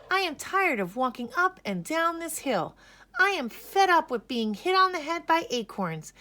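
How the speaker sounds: high, loud complaining bunny voice; fed-up bunny complaint. A narrator's reading. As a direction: whine it out like a fed-up little bunny.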